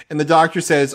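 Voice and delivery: normal talking voice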